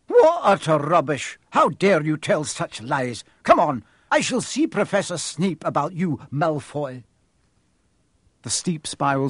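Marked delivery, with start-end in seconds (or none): 0.1-7.0 stern scolding. Acted. McGonagall refusing Malfoy's story, stern. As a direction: dismiss his lies with scorn.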